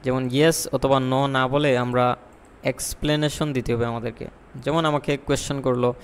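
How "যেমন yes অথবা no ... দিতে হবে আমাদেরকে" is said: ordinary talk, nothing marked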